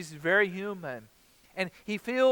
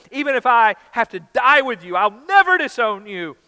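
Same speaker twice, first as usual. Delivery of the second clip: loud, higher protest; emphatic insistence; 7 semitones higher, 12 dB louder than usual. Acted. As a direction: dig in and insist. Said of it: "Peter insisted emphatically".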